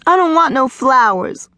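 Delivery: put-on high, sassy girl's refusal; indignant little girl. A narrator's reading. Girl rejects boy's offered flowers.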